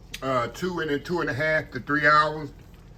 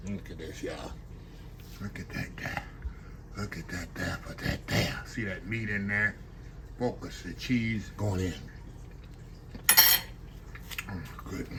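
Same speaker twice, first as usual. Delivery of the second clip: hushed murmur; 7 semitones lower, 10 dB quieter than usual. Real, not acted. Murmuring while eating on camera.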